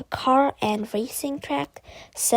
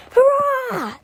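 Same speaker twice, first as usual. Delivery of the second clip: very high, loud hurrah; cheering; 14 semitones higher, 6 dB louder than usual. A narrator's reading. Kids in the playground cheering the victory. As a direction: cheer in triumph. Children celebrate.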